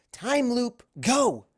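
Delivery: high, loud hurry-up order; high, loud urgent go; urgent command. Acted. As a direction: push her out the door with the words. Twilight urgently sends Luna off.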